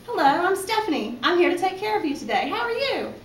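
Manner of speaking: high, loud, put-on customer-service voice; smiling waitress greeting. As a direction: greet the table in an exaggerated high-pitched server voice. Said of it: "I smile".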